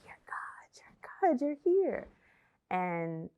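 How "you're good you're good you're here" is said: high whispered reassurance; whispered you're good from the usher; usher's whisper. Retold or imitated. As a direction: hush her calm like a kind usher. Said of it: "she was like".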